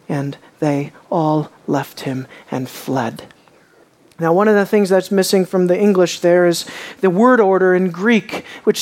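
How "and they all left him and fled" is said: slow, hushed and heavy; solemn slow reading. Acted. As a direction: drag the verse out gravely. Rereading the verse dramatically.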